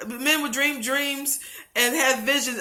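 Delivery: normal talking voice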